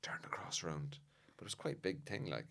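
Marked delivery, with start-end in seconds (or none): 0.0-1.0 urgent whisper from the pews; retold whisper. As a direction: whisper it sharply so the priest can't hear. Man in audience hissing instruction.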